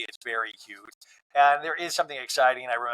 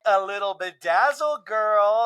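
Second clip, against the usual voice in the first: put-on slow, sassy drawled girl; showing off a sparkly outfit; playful diva tone; sassy Angie K; 6 semitones higher, 4 dB louder, slower than usual. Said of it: "And Angie K's like".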